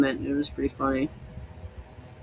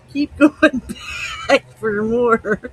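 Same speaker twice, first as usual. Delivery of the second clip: laughing; 7 semitones higher, slower than usual. Real, not acted. Laughing while drinking.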